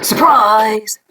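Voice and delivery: high, loud burst of surprise; spring it on them loudly; surprised shout. Acted. Storyteller's sudden exclamation.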